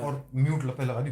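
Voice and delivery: ordinary delivery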